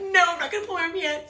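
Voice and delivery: high-pitched whiny protest; retold screaming refusal. Screamer refusing the leader's order, high mock protest. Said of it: "I'm just screaming".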